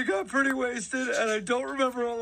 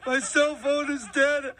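normal talking voice

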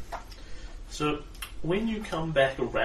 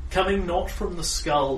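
ordinary talk, nothing marked

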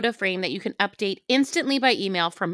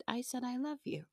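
soft innocent voice; 4 semitones higher, 15 dB quieter than usual. Retold or imitated. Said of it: "And I said".

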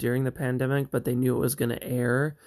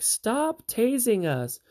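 a hint of higher, slow protest; lightly voiced protesting girls; 9 semitones higher, slower than usual. Abducted sisters pleading with captors, lightly voiced and higher. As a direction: play the feisty sisters lightly.

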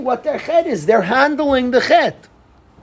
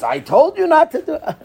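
raised, pointed told-you; hint of a scolding Reuven; 7 semitones higher than usual. Reuven rubs salt into brothers' wounds, lightly scolding.

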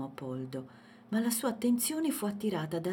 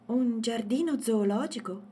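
higher, louder, intrigued; curious question; 5 semitones higher, 5 dB louder than usual. A narrator's reading. Girl asks Poldo about the zoo, curious. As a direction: ask with lifted, wondering voice. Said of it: "chiese Matilde incuriosita".

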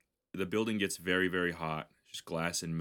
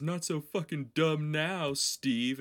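a hint of gloating, higher and slow; mock taunt; 7 semitones higher, slower than usual. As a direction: rub it in, lightly voiced. Taunt printed on parachute.

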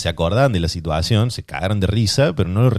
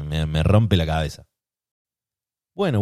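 ordinary talk, nothing marked

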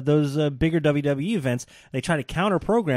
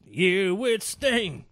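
slow echo; 6 semitones higher, much slower than usual. Retold or imitated. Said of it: "echoing the partner's words".

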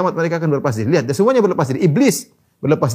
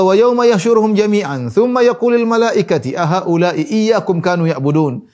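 slow, raised recitation; forceful scripture recitation; 5 semitones higher, 4 dB louder, slower than usual. Acted. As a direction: declaim the Arabic verse with drawn-out vowels. Recites verse in preaching voice.

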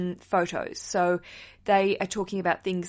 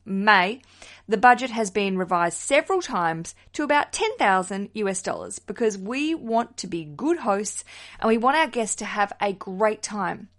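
ordinary talk

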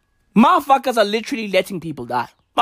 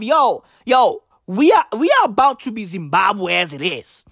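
usual speaking voice

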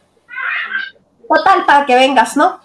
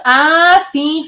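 ordinary delivery

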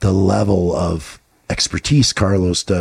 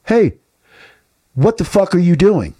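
friend calling him out; 8 semitones higher than usual. Retold or imitated. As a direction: snap him out of it like a real friend. Friend confronts him bluntly.

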